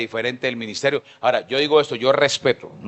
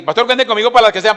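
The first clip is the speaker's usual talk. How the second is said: imita alto y fuerte a un voluntario entusiasta; imitando a alguien dispuesto; 10 semitones higher, 10 dB louder than usual. Retold or imitated. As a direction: remeda con exageración al que promete todo. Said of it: "gente que así". (imitación burlona de un creyente entusiasta)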